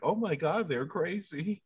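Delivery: soft murmured aside; quiet. Retold or imitated. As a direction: say it softly, a little shocked. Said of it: "She's like".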